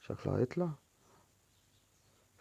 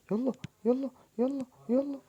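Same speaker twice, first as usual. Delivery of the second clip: high-pitched urging. Real, not acted. Urging the game on.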